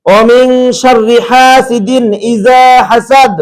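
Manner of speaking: slow, drawn-out tajweed chant; Quran recitation. Shown as a demonstration. Teacher reciting the correct verse slowly, like a Quran reciter. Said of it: "recites verse as demo".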